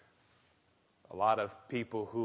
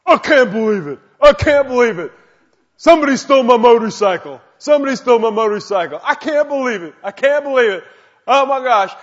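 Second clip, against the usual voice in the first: very high, loud, panicked rant; frantic brother on voicemail; 13 semitones higher, 18 dB louder, faster than usual. Retold or imitated. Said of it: "He says".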